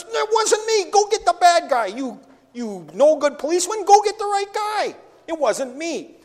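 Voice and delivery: high, loud indignant protest of innocence; mock indignant denial; protesting suspect. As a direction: play the wrongly accused man yelling at the cop.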